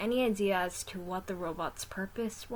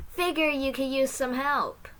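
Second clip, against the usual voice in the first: higher, louder call from afar; 7 semitones higher, 8 dB louder than usual. Acted. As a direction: call out arriving to help. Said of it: "a voice called out".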